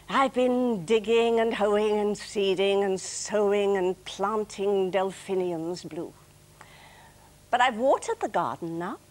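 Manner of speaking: weary comic verse, some lines slowed right down; lightly performed tired gardener poem; poem recitation. Acted. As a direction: recite it like someone worn out after a day of gardening. Recites her final gardening poem.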